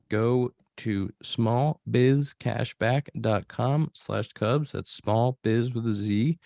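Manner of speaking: slow, careful sponsor read; measured podcast ad voice. Acted. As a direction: read it like a radio spot, deliberate.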